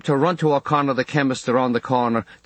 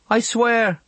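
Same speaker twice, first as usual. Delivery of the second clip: put-on much higher, small child's earnest oath; a frightened boy promising his mother; boy's oath; 12 semitones higher than usual. A narrator's reading. Boy swearing to Mam.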